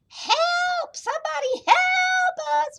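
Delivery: very high drawn-out cries for help; squealing storybook distress; wailing animals. A narrator's reading. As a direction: wail in hammed-up panic. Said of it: "wailed the animals".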